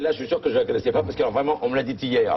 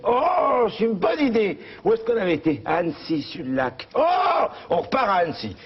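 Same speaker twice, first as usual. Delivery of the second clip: put-on excited old husband; 8 semitones higher than usual.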